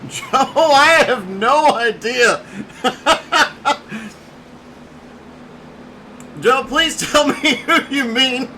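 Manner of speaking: breaking into laughter between phrases; real giggles interrupting the words; laughing. Real, not acted. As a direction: try to talk while cracking up. Cracks up during live commentary.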